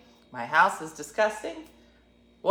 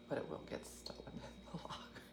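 breathy whispered aside; 3 semitones lower, 21 dB quieter than usual. Retold or imitated. Whispered aside to the audience about the locker. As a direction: mutter the doubt under your breath.

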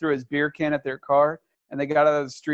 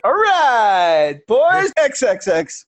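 announcer voice; 7 semitones higher, 6 dB louder, much slower than usual. Acted. Intro hype call.

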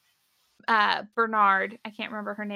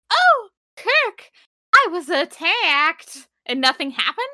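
mock damsel; 5 semitones higher, 5 dB louder, slower than usual. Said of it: "you think she's just".

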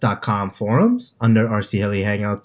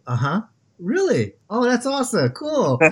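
fake interested cohost; 9 semitones higher than usual. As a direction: toss in bright canned reactions. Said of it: "you have little blurps of you say".

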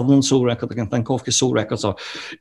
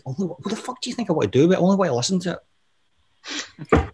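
fast, exasperated mock snap, pitched up; impatient retort; 6 semitones higher, faster than usual. Friend losing patience over vinyl, fast exasperated snap. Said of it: "he kinda lost his patience with me".

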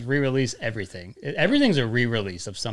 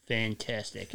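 grumpy deadpan; 2 semitones lower, 8 dB quieter, much slower than usual. Shown as a demonstration. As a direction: say it like you don't care. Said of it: "like that grumpy cat".

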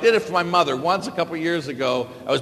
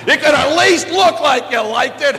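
higher, louder exasperated yell; yelling at mother; 8 semitones higher, 7 dB louder than usual. Retold or imitated. Son yelling at his mother over the unwanted gift, loud. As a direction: let it burst out at her. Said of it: "I start yelling at my mother".

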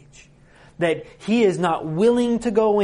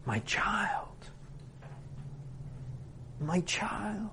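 whispered, aching tenderness; a father's hushed, pained appeal; heartache; 5 semitones higher, 14 dB quieter, much slower than usual. Acted. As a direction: breathe it softly, higher and full of hurt. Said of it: "the heartache in his voice".

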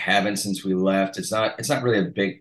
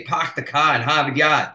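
the classic Boston "Park the car in Harvard Yard", r's dropped, higher; Boston accent; 6 semitones higher, 3 dB louder than usual. Retold or imitated. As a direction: show off the old Boston accent in fun. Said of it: "even though I used to say".

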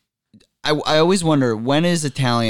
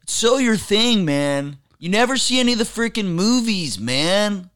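the Dude; 8 semitones higher than usual. Pretend. Guest in character chiding co-host over movies, high slacker voice. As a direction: needle him as a put-on movie slacker. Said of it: "Oh, you could do the dude".

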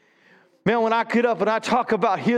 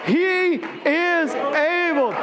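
higher, slow and emphatic; rising fervour; 8 semitones higher, much slower than usual. Shown as a demonstration. Pastor building the church up, higher and fervent. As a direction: lift it up with passion. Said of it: "Say that with me again".

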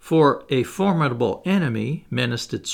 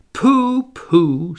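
high, loud dismissive pooh-pooh; scoffing interruption; 5 semitones higher, 7 dB louder than usual. A narrator's reading. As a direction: cut in and brush it away loudly. Ardan scoffs, interrupting the duel talk.

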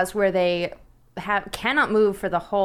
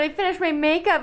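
ordinary delivery

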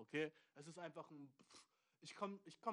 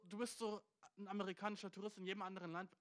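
usual speaking voice

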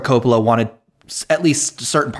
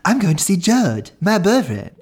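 high, put-on sitcom girl voice; mock teen-sitcom voice with swooping high pitch; Jan Brady; 7 semitones higher than usual. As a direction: imitate a girl boasting about her boyfriend, pitched up. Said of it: "like Jan Brady says".

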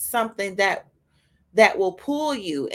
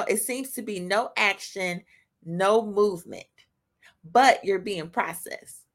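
ordinary talk, nothing marked

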